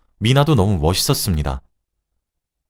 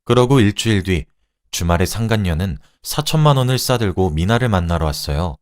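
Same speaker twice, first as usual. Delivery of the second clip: ordinary delivery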